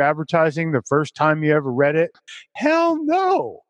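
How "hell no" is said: high emphatic no. Real, not acted. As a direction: scoff it off, pitched up. Emphatic rhetorical answer.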